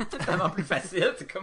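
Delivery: quick, high, through laughter; real giggly relief; laughing. Real, not acted. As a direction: blurt it out while laughing. Laughing after mangling names.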